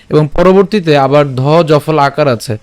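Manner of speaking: ordinary delivery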